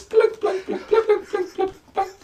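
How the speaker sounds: very high, plinking nonsense notes; squeaky comic plunking; imitated xylophone plunks. Shown as a demonstration. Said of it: "they start talking like this".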